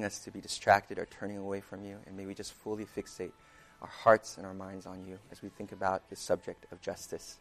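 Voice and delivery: soft, hushed prayer voice. Acted. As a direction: pray quietly and humbly. Opening prayer before sermon.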